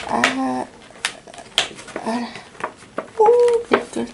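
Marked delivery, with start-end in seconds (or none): 3.2-4.0 sudden high yelp of surprise; surprised curse. Real, not acted. Opening the Pokémon box, a startled high curse. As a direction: blurts it out, caught off guard. Card reveal, sudden exclamation.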